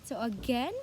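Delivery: ordinary talk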